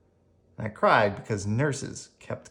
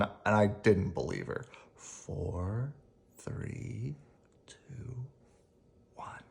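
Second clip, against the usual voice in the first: fading into sleep; slower than usual. Acted. Counting down into anaesthesia.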